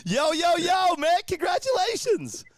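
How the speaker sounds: high, loud, thrilled greeting; excited. Real, not acted. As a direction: congratulate with big excitement. Announcing the sand car winner.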